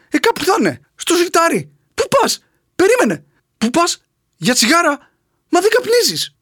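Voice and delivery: high, excited comic back-and-forth; frantic courtroom skit. Acted. Clerk and prosecutor acted scene.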